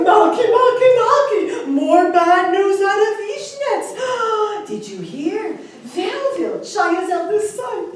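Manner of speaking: loud, high, frantic call; screaming out. A narrator's reading. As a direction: scream the bad news out to your wife, with gasps. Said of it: "the man screamed out for his wife".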